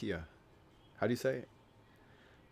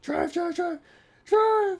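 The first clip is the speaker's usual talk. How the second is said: very high-pitched squealed repetition; meme character; 6 dB louder, much slower than usual. Retold or imitated. Retelling a drive-thru meme, in a very high squeal. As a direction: squeal it frantically, climbing louder on the last one. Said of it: "He's like".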